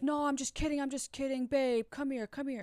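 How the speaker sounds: high, coaxing mock "only teasing" voice; placating backtrack. Imitates boyfriend hastily backtracking.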